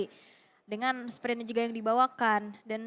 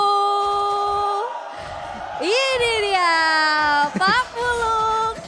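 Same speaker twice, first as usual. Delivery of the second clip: high, loud, drawn-out hype; hype call; 6 semitones higher, 7 dB louder, much slower than usual. Real, not acted. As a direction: sell the big moment to the crowd. Caster hypes a big play.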